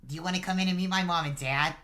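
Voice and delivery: put-on high, fast, loud kid voice; eager little boy. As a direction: rush the invitation out like an excited child. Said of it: "he goes".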